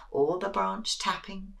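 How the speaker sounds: usual speaking voice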